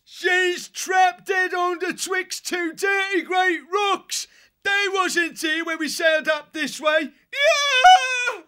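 high, loud drawn-out shouting; panicked lookout's yell. A narrator's reading. Lookout yells to crew that ship is trapped, yelling. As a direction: yell it from the bows, ending in a scream.

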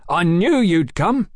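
high, loud, drawn-out greeting; eager cry. A narrator's reading. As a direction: cry out slowly in delight. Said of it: "cried Tom".

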